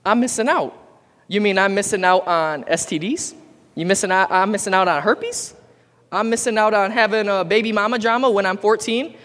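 rising, sarcastic high questions; mock incredulous. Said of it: "I go".

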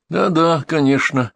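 mildly distracted assent; absent-minded. A narrator's reading. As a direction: agree with your mind elsewhere. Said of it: "рассеянно сказал Архипов".

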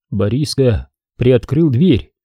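ordinary delivery, nothing marked

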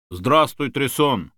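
ordinary delivery

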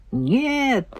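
high, wailed denial; plaintive wail without extra volume; wailing cry. A narrator's reading. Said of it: "возопила Стелла".

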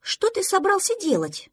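high-pitched sharp question; suspicious challenge. Acted. Duckling catches friend sneaking off, high challenge. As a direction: demand an answer, pitched high.

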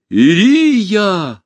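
high, long cry of a name; calling out loudly. A narrator's reading. Tadeush calling his wife across the hall, loud cry. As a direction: call over the noise of a crowd. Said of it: "голос Тадеуша перекрыл шум зала".

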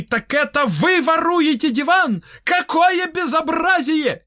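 high, slow indignant wail; yelling. A narrator's reading. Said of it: "завопил Толстый". (высокий тягучий вопль возмущения)

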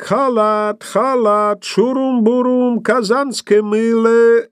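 high sing-song peddler call; vendor cry. A narrator's reading. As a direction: call out like a street hawker. (крик торговца-старьёвщика)

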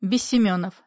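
usual speaking voice

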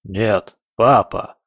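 scoffing snort of a dog. Acted. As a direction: snort it out, mocking. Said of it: "фыркнула собака". (насмешливо фыркая)

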